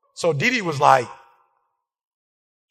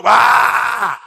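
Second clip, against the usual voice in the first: loud, sharp mock yell; mock shout; 3 semitones higher, 8 dB louder than usual. Preacher imitating his own yell while his wife was fussing, loud. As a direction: blurt a startled yell. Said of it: "I was going".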